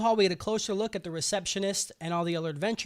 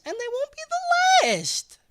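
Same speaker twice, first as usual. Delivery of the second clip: mock-dramatic verdict; 5 dB louder than usual. Goblin Slayer refusing the rescue, high mock-dramatic finish. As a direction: jump up high and loud. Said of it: "he's like".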